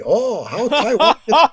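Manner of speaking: high, laughing put-on Chinese accent. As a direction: try out a caricatured Chinese accent, half laughing. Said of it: "if we could put a Chinese voice on Will".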